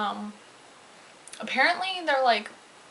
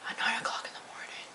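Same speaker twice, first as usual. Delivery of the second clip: whispered as if the hour is horrifying; mock dread whisper; 11 dB quieter, faster than usual. Vlogger recalls the dreaded early hour, whispered. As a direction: hush it like a grim secret.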